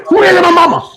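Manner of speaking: high, loud playful shout; mock outburst. Host warning viewers off her, mock protective shout. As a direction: holler it way up high. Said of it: "I want me to say".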